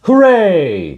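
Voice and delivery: high, loud cheer; hooray cheer. Acted. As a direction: shout it like a party. Cheers on celebratory.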